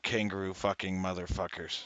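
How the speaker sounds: usual speaking voice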